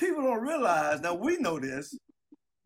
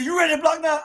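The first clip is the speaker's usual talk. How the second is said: raised, loud demanding question; imitating a tough coach; retold coach's challenge; 6 semitones higher, 6 dB louder than usual. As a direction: challenge a beaten player loudly. Coach challenging crying player, retold.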